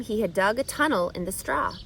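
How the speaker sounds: ordinary delivery, nothing marked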